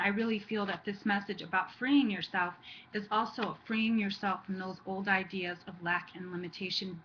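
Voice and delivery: ordinary talk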